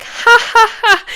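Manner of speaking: loud, high forced laugh; fake laugh. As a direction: ham up an awkward nervous laugh. Covering for own fart.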